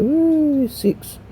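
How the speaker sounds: a sudden high whoop, then the number; happy cheer. Real, not acted. Cheering a game reward.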